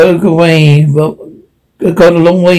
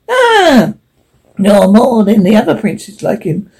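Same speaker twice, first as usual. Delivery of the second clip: bird voice, pitched up; high magpie voice; 6 semitones higher than usual. Acted. High bird voice adding a remark. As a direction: magpie adding to the talk about princes.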